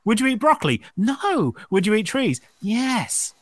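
put-on high, slow eager child. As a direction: play a keen little kid. Kids answering, parent asking.

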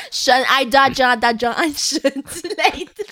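put-on slow, laughing foreign-accented Mandarin; preachy foreigner accent, drawn out; foreigner speaking mandarin. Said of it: "外国人讲中文的腔调". (老外腔调慢慢说)

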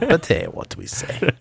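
high, slow comic Russian shrug; Russian accent. Pretend. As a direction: brush it off in high mock Russian. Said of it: "Russian Brandon".

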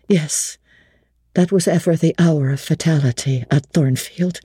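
a respectful butler recounting the disaster; old butler's voice. Acted.

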